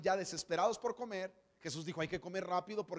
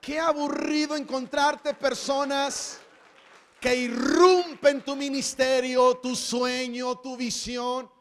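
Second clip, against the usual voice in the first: loud, slow, high preaching; preacher shouting to the congregation, slow and loud; 5 semitones higher, 12 dB louder, slower than usual. Real, not acted. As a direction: preach it out at full force.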